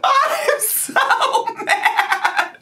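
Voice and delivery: very high squeal through laughter; helpless laughter. Real, not acted. Vlogger reacting to the clip, helplessly laughing. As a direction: drag the words out, squeaking with laughs. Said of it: "I can't stop laughing".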